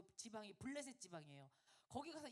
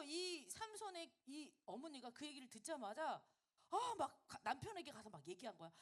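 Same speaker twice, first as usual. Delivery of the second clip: ordinary talk, nothing marked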